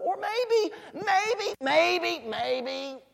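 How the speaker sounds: high, teasing repeated maybe, stepping down; playful mocking singsong; Elijah. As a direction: jeer with pretend guesses, pitch falling each time. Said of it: "Elijah began to taunt them".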